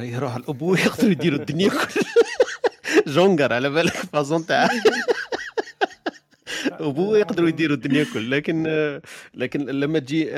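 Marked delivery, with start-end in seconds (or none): 1.9-3.1 loud burst of laughter; hearty laugh. Real, not acted. Hearty laugh in lively talk. 4.7-6.2 loud high burst of laughter; hearty laugh. Real, not acted. Laughing at own joke.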